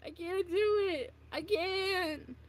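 high, loud, overwhelmed apology; mortified outburst. Real, not acted. Streamer cringing at own song, high mortified wail.

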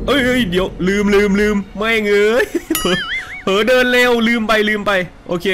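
flustered. Real, not acted. Panicked after walking too fast.

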